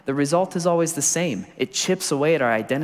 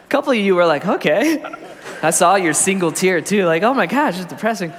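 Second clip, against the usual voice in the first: higher and louder, laughing through it; laughing tease; 6 semitones higher, 5 dB louder than usual. Real, not acted. Preacher laughing at the congregation's gloomy faces. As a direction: tease them while cracking up.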